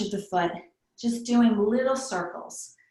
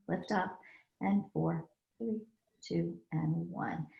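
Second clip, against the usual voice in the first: soft counted yoga cues, easing down; calm instructor counting breaths; yoga breath cueing; 2 semitones lower, 5 dB quieter than usual. Acted. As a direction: count them through the breath, gently.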